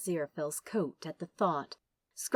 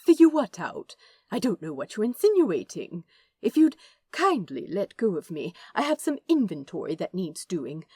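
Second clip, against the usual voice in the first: indignant angel brushing it off, louder; flustered prim angel; 7 dB louder than usual. Acted. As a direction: bluster primly to change the subject. Aziraphale flustered deflection.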